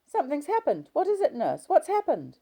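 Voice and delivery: high, loud, panicked cry; a scared patient calling for the nurse, high and loud; frightened cry. A narrator's reading. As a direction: cry out in sudden fear. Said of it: "she cried out".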